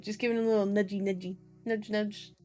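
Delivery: quick, playful murmur; playful aside. Pretend. Reader joking about pushing the speed limit, playful. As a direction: toss it off as a cheeky little joke.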